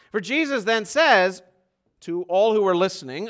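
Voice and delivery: ordinary talk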